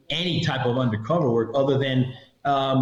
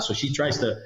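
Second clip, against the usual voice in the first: ordinary delivery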